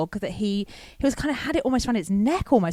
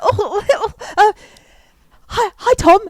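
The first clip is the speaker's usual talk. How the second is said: high, loud, stammered greeting with pauses; retold panic; 12 semitones higher, 8 dB louder than usual. Caller greeting radio host on air, flustered and panicky. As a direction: fumble for words in comic panic. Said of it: "So I panicked".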